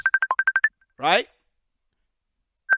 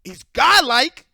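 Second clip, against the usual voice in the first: much louder, slow and drawn out; emphatic loud; 4 semitones higher, 10 dB louder, slower than usual. Real, not acted. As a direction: lean hard into godlike, slowly. Emphatic praise of Dismantle.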